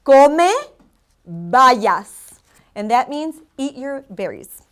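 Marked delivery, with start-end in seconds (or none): 0.0-2.4 teacher modelling come bayas word by word; enunciated lesson model; slow Spanish drill. Shown as a demonstration. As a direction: come loud and rising, then bayas dropping to a breathy fall. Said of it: "We're gonna try that one more time".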